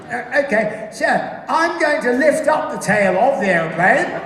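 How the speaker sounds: acted officer briefing the crew; pilot giving instructions. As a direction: spell out the plan, slowly and high.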